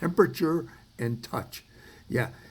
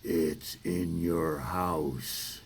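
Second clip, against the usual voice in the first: low, very slow, creepy line; horror film voice; 7 semitones lower, 4 dB quieter, much slower than usual. Acted. Comedian quoting the horror film, low creepy drawl. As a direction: draw it out low like a horror movie warning. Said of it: "like the horror film says".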